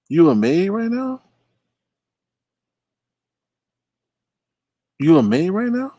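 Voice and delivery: high-pitched, baffled query; incredulous question. Real, not acted. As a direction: question them high, in utter disbelief. Questions the chatter in disbelief.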